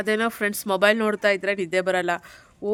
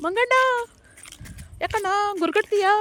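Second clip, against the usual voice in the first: high, drawn-out call to monkeys; singsong high animal-greeting voice; playful calling out; 7 semitones higher, 3 dB louder than usual. Real, not acted.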